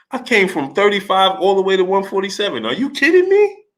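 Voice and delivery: high, incredulous mock Manny voice climbing to a squeak; imitating Manny. Host imitates Manny bragging about moving up weight, high incredulous. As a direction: sell the disbelief, push the last line way up. Manny on his biggest win.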